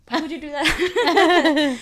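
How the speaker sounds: slow, loud, laughing fake reproach; laughing mock scold. Girlfriend teasing her boyfriend for threatening the ex, laughing scold. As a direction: scold him while cracking up.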